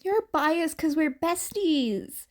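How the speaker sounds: lightly voiced girl teasing; a hint of a playful girl; Uraraka teasing. A narrator's reading. Girl teasing her best friend, light and playful.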